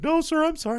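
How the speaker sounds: put-on squeaky apologetic kid; high and sorry like a teen clerk. Teen worker voiced in story.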